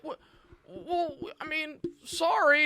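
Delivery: high, hesitant mock apology; flustered studio exec. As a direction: stammer an awkward sorry. Acting studio execs flustered.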